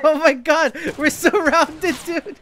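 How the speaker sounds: high, loud panicked yelling; panicked shout. Real, not acted. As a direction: panic at the top of your voice. Panicking when surrounded in game.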